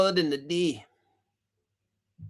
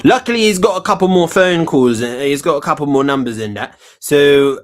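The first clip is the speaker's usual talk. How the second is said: loud host; 12 dB louder than usual. Acted.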